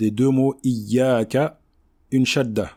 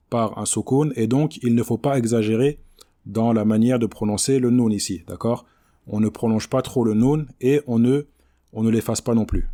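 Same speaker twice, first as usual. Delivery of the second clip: ordinary talk, nothing marked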